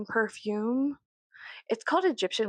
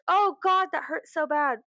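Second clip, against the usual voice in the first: higher, louder mock ow; fake pain; 6 semitones higher, 3 dB louder than usual. Pretending a head-butt hurt, a mock pained cry. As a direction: ham up a faked hurt. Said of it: "I'm like".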